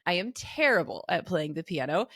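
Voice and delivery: normal talking voice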